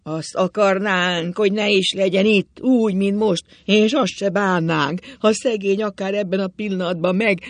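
provocative high-pitched tirade. A narrator's reading. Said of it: "egyre emelte hangját, mely megbicsakolt, rikácsolt".

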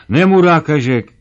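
high, loud indignant outburst; shouted grumbling. A narrator's reading. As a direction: throw it out as an insult. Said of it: "Rupi még mindig kiabál".

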